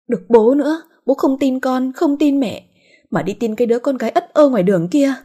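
high-pitched, indignant daughter's complaint; angry at dad. A narrator's reading. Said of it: "Tuyết giận bố". (giọng giận dỗi trách bố, cao vút)

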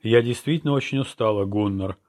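plain reading voice

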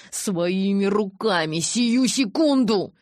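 hatred boiling over; rising rage. Acted. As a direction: spit it out in fury. Jacob rages at Edward.

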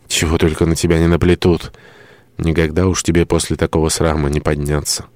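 usual speaking voice

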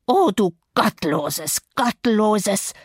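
slow, wheezy outcry of horror; gasping old man. A narrator's reading. Pious old servant gasps at the young woman's witchcraft. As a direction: gasp it out, aghast. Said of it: "keuchte der Alte".

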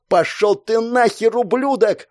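high, drawn-out furious yell. A narrator's reading. Enraged girl cutting off her comrade, yelling. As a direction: scream the curse at him. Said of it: "завопила она".

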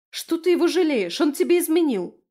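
high, loud outraged protest; indignant. A narrator's reading. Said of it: "возмутилась подруга".

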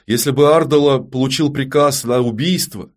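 mildly scornful, forceful rebuke; angry. A narrator's reading. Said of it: "зло бросила Ярина".

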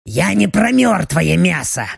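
demon with a touch of thrill; eager otherworldly voice. Acted. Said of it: "В голосе демона слышался азарт". (азартный голос демона)

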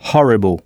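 Horrible with a breathy h, not silent; h prononcé; English h sounded. Shown as a demonstration. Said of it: "le H anglais ici n'est pas muet".

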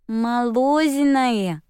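put-on slow babyish child voice; high little girl voice; toddler voice. A narrator's reading. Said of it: "безразлично ответила та".

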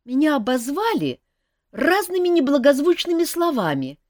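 put-on young elf prince. A narrator's reading. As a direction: confess it seriously but eagerly. Принц жалуется на обиду. (молодой принц-эльф, серьёзно, выше и громче)

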